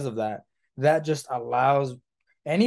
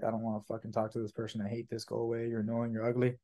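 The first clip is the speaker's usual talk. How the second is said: low-key grumbling thoughts, much softer and quick at first; resentful inner monologue; 3 semitones lower, 8 dB quieter, faster than usual. Acted. Rep silently resenting the person on a sales call, muttered inner monologue. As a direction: grumble it under your breath as if thinking it.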